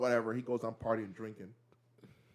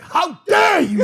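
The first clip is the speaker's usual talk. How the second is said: a big, slow, high outraged shout; furious "how dare you"; 14 dB louder, slower than usual. Retold or imitated. A brother storming in, shouting in rage. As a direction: bellow it like a wronged king in a rage. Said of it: "he walks in, he's like".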